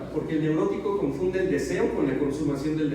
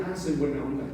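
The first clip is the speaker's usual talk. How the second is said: accepting it; 4 semitones lower, 4 dB quieter than usual. Retold or imitated.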